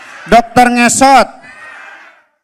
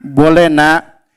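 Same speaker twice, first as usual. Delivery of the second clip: lower, calm fatherly reply; 8 semitones lower, slower than usual. Acted. Father answering his son Abdi, low and calm. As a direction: answer as a gentle dad.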